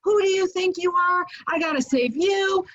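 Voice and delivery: high, loud furious scolding; angry friend yelling. Retold or imitated. Rescuer friend scolding the reckless surfer, furious. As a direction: chew him out like a scared friend. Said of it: "and he's like".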